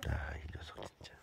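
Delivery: soft exasperated whisper. Real, not acted. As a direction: breathe it out under your breath. Exasperated with the cat. (작게 속삭이며 투덜대듯)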